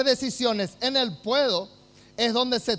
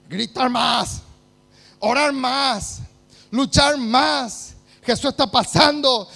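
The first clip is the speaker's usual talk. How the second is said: preaching at full force; 12 dB louder than usual. Acted.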